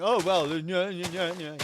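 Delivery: whiny nyeh-nyeh mimicry; mock nitpicker. Streamer mocking the guy who argues over definitions, nitpicking. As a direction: imitate a nagging pedant in nonsense syllables. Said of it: "I don't wanna be that guy".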